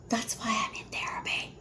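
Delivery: whispered aside. Real, not acted. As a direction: hush it to the camera like a secret. Aside while kids scream.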